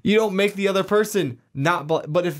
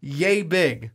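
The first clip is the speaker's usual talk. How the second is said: hammed-up Boston, dropped R's; brash mock Boston townie; pretend Boston accent. Cohost boasting about lobster size, pretend Boston accent. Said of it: "Do you like my Boston accent?".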